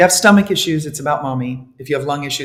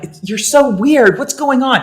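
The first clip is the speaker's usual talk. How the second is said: higher, louder mock confusion; imitating baffled coworkers, raised pitch; mock puzzled friends; 8 semitones higher, 5 dB louder than usual. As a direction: friends asking what's wrong, mock bewildered. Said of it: "And they're like".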